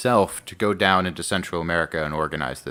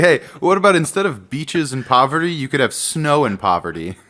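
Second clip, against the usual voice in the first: laughing mock pitch; 8 semitones higher, 5 dB louder than usual. Mocking recruiter pitch.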